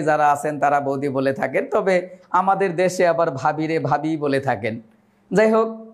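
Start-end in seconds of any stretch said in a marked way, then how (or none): none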